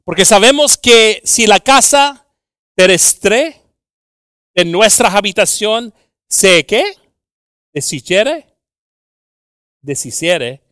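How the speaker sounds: loud, high, impassioned scripture preaching; full-force preaching. Real, not acted. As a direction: belt out the verse to the congregation.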